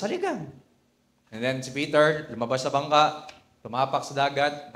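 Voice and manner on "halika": inviting call. Acted. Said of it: "Sabi ni Jesus".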